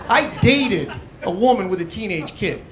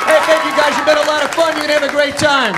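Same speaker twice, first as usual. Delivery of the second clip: loud sign-off; 7 semitones higher, 6 dB louder than usual. Real, not acted. Closing sign-off to crowd.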